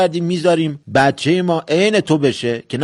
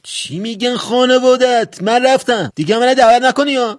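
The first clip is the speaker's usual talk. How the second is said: indignant guest walking out; offended outburst; 7 semitones higher than usual. Acted. Guest leaving the family home in offence, high indignant outburst.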